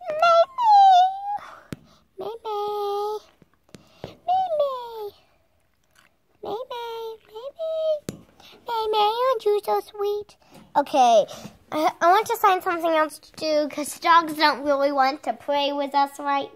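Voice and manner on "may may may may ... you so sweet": calling the dog. Real, not acted.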